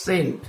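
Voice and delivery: সেন্ট opening on a plain s; silent c. Shown as a demonstration. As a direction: say সেন্ট with s straight into the vowel. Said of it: "কিন্তু উচ্চারণটা হচ্ছে". (সেন্ট-এ সি অনুচ্চারিত, শুধু স)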